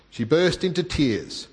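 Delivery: normal talking voice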